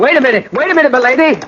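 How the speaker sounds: high, urgent call; calling after. Acted. Brian calling after the fleeing lady, high urgent call. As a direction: call after her as she rides off.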